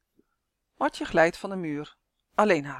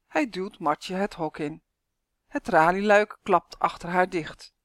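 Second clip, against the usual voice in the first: ordinary delivery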